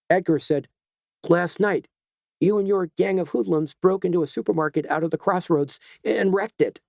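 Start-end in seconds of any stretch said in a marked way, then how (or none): 1.3-6.8 raised, higher accusation; accusing. A narrator's reading. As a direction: confront him with it. Edgar accuses the vandal.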